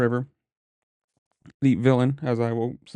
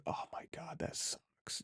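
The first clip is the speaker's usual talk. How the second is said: hushed, whispered retold reaction; whispered aside; 19 dB quieter, slower than usual. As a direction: whisper it as a private wince of sympathy. Retelling his reaction from outside.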